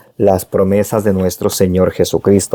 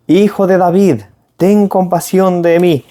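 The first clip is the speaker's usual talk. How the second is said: crying out louder; 8 semitones higher than usual. Retold or imitated. Preacher voicing the blind beggar crying to Jesus, high desperate plea. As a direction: call out desperately for mercy, voice lifted high. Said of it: "a gritar y grita más fuerte".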